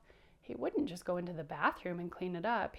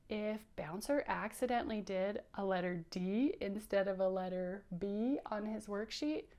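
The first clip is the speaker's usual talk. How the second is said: ordinary delivery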